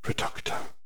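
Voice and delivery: a breathed killing spell, almost soundless; barely audible incantation. Acted. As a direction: whisper the curse coldly. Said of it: "just barely loud enough for Hermione to hear".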